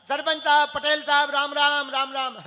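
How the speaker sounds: loud, high respectful hail; loud greeting. Retold or imitated. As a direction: call out to the chief from afar. Greeting the village chief loudly.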